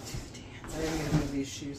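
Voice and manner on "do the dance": breathless whisper to herself. Real, not acted. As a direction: whisper it softly. Whispered echo of instruction.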